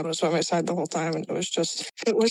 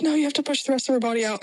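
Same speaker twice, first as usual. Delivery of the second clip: quick, higher urgent instruction; urgent medic; 6 semitones higher, much faster than usual. Retold or imitated. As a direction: hurry her along like a paramedic. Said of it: "they were like".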